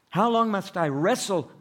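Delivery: high, loud anguished question; pleading cry. Acted. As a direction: cry out the struggle. Voicing David's psalm.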